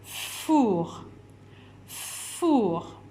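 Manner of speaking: "Four" with a long drawn-out f; slow "Four", starting on a lengthened fff; stretched first sound. Shown as a demonstration. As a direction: hold the f at the start of "Four" so children hear it. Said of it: "le son que vous entendez au début".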